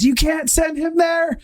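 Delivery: high, slow mock protest from the wife; imitating the wife. Wife protesting in background, high mock voice. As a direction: squeak out the wife's objection through laughter.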